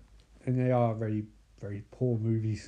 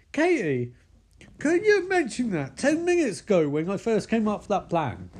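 high-pitched comic indignation; exasperated complaint; 7 semitones higher than usual. Acted. Micah scolds Katie for telling him too late, exasperated. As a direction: protest it in disbelief.

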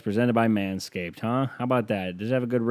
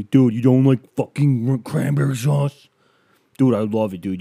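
outraged bro; 3 semitones higher, 6 dB louder than usual. Retold or imitated. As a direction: scold like it's a crime. Outraged guest ranting.

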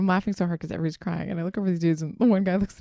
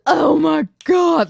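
gasp, then a loud, high, drawn-out mock sob; hammed-up weeping outburst; mock melodramatic crying; 9 semitones higher, 12 dB louder, much slower than usual. Guest parodying an overly serious movie scene, hammed-up sobbing.

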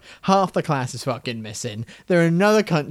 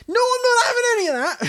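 much higher mock-whining refusal; mocking squeaky protest; high whiny voice; 3 dB louder than usual. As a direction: whine it up high like a cartoon. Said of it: "I was expecting you to be like".

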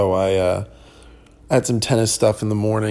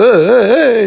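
high, loud hammed-up hey hey hey; mock hype yells; 12 semitones higher, 8 dB louder than usual. Dad hamming it up in Home Depot, loud high yells. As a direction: bellow it like a goofy dad showing off. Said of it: "he's making noise".

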